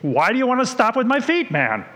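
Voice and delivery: high, pleading, eager; eager Peter. Retold or imitated. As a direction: beg to be washed all over, pitch up. Teacher plays eager Peter.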